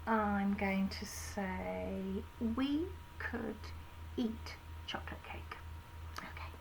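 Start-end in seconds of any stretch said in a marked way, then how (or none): 2.6-6.5 whispered. Acted. Whispering the letter words.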